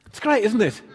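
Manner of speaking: laughing aloud with delight; enjoy the joke, laugh through it. Real, not acted. Laughing at Jehu's driving.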